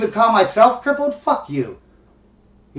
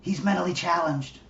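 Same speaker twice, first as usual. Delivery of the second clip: hushed correction, softer and lower; mock whispering; 4 semitones lower, 9 dB quieter than usual. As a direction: quietly correct someone as if it's taboo. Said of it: "you know, whispering".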